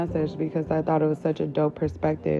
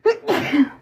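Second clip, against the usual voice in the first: sudden loud sneeze; 5 dB louder than usual. Real, not acted. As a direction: let a sneeze burst out. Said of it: "I'm gonna sneeze again".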